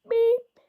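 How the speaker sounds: high, loud mock censor bleep. Shown as a demonstration. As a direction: shout bip like a censor beep. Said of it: "Je fais bip au lieu de faire".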